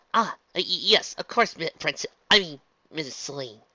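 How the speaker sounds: high, loud, stumbling start; stammering correction. A narrator's reading. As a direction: trip over the title and catch yourself. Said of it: "Twilight stirred".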